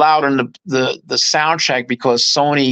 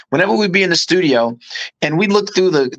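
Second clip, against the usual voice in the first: ordinary talk, nothing marked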